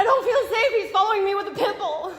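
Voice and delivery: panicked yelling about the pit bull; real screaming. Real, not acted. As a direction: shout it, scared and desperate. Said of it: "you don't need to be screaming like that".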